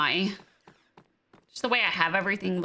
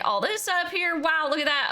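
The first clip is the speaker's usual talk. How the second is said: ordinary talk, nothing marked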